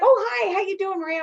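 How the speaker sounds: high delighted hello from a nurse; staff's happy reply. Retold or imitated. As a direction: play the nurse, pleased to see her. Staff voiced greeting her.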